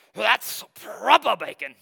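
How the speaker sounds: slow, loud, bad Scottish accent on "That's proper bacon"; a hammed-up, amateur Scottish accent. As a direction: mimic the Scottish waiter in a clumsy Scottish accent. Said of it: "That's not a very good Scottish accent".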